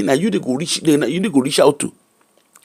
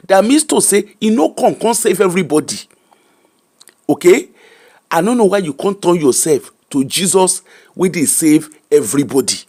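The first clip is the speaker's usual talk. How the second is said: usual speaking voice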